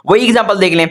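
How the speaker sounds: ordinary delivery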